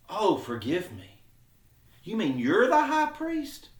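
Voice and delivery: passive-aggressive mock apology, pitch leaping in feigned disbelief; sarcastic. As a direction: put on surprise as a backhanded verbal slap. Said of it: "kinda being sarcastic".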